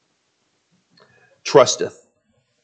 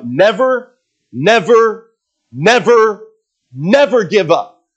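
each NEVER punched higher, spaced by pauses; Churchill quote; 7 semitones higher, 3 dB louder, slower than usual. Retold or imitated. Preacher quotes Churchill on repeating words, emphatic NEVER each time. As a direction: hammer out NEVER, one at a time. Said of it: "Winston Churchill said this".

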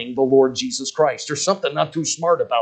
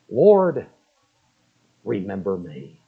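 humble prayer; 8 semitones lower, slower than usual. Retold or imitated. Thief's prayer.

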